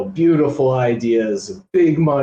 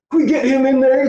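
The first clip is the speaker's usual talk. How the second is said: high, louder mocking whine; mocking imitation; 12 semitones higher, 4 dB louder, faster than usual. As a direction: mimic him eagerly asking. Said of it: "he's like".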